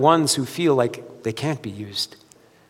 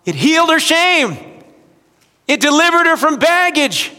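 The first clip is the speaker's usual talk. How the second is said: raised preacher's voice, driving each line home; full-force preaching; 8 semitones higher, 6 dB louder than usual. Real, not acted. Pastor proclaiming what living water does, preaching hard. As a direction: lift it high and loud like a revival call.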